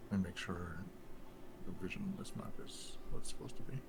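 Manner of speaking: whispered to himself while checking; whispering. Real, not acted. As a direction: mutter it under your breath. GM checks map, mutters to self.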